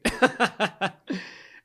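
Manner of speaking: high bursting laugh; real laugh. Real, not acted. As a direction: laugh out loud. Laugh after quote.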